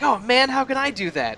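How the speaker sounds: high, loud eager cry; excited Luke. Retold or imitated. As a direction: burst out, desperate to learn the trick. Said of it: "and Luke's like".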